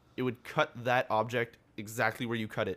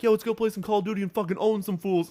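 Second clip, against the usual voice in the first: higher, louder rallying of buddies; mock hyped gamer; 10 semitones higher, 7 dB louder than usual. Gamer calling buddies to play Call of Duty, mock-hyped. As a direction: imitate a pumped-up player egging friends on.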